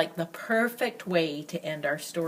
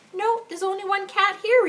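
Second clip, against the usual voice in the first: high, a little louder, amazed; 12 semitones higher, 5 dB louder than usual. A narrator's reading. Boy answering angry Sarah, amazed. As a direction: sound amazed at the question.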